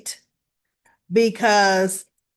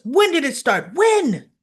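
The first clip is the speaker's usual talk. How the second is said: high, louder demand, pressing for an answer; 5 semitones higher, 5 dB louder than usual. Retold or imitated. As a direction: push her hard: when.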